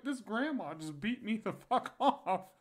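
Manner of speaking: high, slow, cracking up mid-line; laughing punchline. Real, not acted. Telling the podcast host the punchline, laughing through it.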